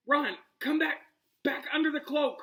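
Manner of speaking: out of breath, high and urgent; panting. Acted. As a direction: pant the words. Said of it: "Hermione panted".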